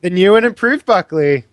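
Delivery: loud, high presenter's announcement; grand introduction. Acted. Player introduces the new and improved Buckley, grand announcement. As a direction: present him like the star act.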